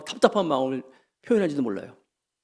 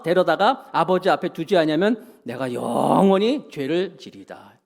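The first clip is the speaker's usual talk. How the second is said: congregational reading; 2 semitones higher, 5 dB louder, slower than usual. Acted.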